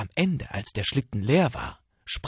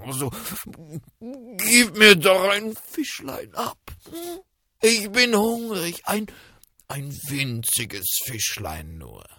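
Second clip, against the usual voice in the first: wolf faking sweetness; 10 semitones higher, 7 dB louder than usual. Acted. Wolf begging the fox.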